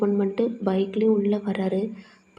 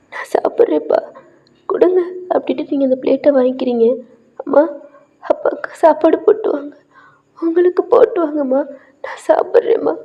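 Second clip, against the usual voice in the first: higher and louder, words pushed out through sobs; crying; 5 semitones higher, 6 dB louder than usual. Acted. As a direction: give in while crying. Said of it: "அழுதுகிட்டே சாப்பிட்றீங்க". (அழுதுகொண்டே உரக்கப் பேசுதல்)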